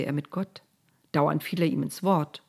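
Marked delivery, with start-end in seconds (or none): none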